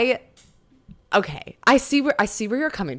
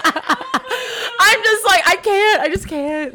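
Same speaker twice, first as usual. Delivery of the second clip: giggling through the words; can barely talk for laughing; 9 semitones higher, 6 dB louder, much slower than usual. Real, not acted. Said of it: "laugh cry".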